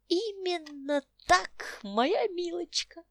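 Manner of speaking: put-on high, slow triumphant old man; solemn old man. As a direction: proclaim it grandly as an old codger. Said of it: "ответил старик торжественно".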